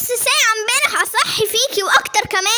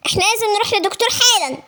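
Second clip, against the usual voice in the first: ordinary delivery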